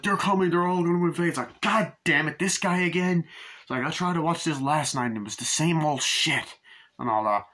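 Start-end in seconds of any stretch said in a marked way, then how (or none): 0.0-1.3 higher, louder, fast imitation of an alarmed broadcast; urgent TV warning. Man on TV warns the viewers, urgent imitation. 1.6-6.6 higher-pitched mock exasperation at the television; exasperated viewer. Viewer complains about the interrupted show, exasperated imitation.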